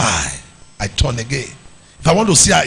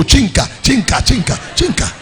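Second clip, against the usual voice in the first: comb noise imitation. Mimicking comb sound.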